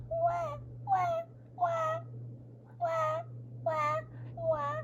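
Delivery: put-on very high, thin baby wails; short squeaky storybook baby cries; baby crying. A narrator's reading. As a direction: imitate a far-away infant's wah.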